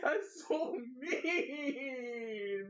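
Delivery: very slow, high wail through laughter; laugh-crying. Real, not acted. Streamer laugh-wailing at chat's roast. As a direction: whine it out while cracking up.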